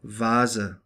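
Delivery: German Vase with a voiced V sound; V as English V. Shown as a demonstration. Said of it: "or like a V as in".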